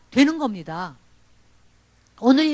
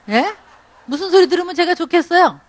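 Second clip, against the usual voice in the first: usual speaking voice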